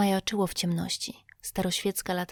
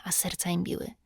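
ordinary delivery, nothing marked

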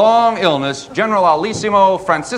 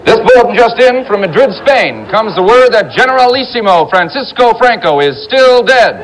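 loud bulletin; 4 semitones higher, 6 dB louder than usual. Acted. Comic shouted news bulletin.

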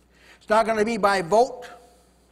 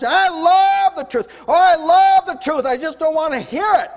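higher, louder hammed-up protest of love; mock the hypocrite's eager excuse; mock churchgoer; 5 semitones higher, 6 dB louder than usual. Preacher mocking a hypocrite at the judgment seat, hammed-up. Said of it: "stand before the judgment seat".

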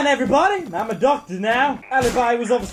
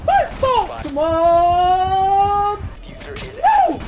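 high, loud, drawn-out cries; desperate urging; 7 semitones higher, 3 dB louder, much slower than usual. Real, not acted. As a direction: scream them on through the fight. Urging team in firefight.

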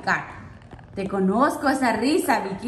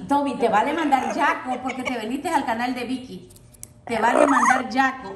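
ordinary talk, nothing marked

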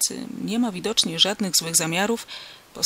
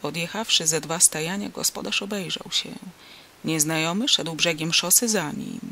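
plain reading voice

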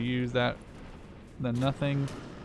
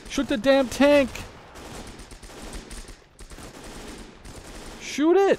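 frustrated yelling; 13 semitones higher, slower than usual. Real, not acted. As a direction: scream the order. Repeat, rising frustration.

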